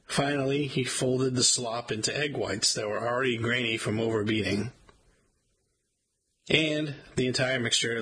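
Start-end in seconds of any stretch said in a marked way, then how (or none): none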